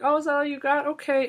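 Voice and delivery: high, mocking taunt; hammed-up high taunt before a fight. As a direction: goad the opponent in a high voice. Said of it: "She would just be like".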